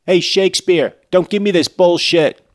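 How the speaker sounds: loud, high, fed-up yell; loud exasperated shout. Real, not acted. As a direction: shout it at the author. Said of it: "repeated louder".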